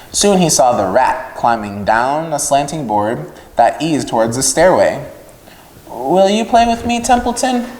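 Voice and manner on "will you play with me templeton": high, eager little pig; a lonely piglet asking; hopeful piglet. Acted. Wilbur asking Templeton to play, high and hopeful.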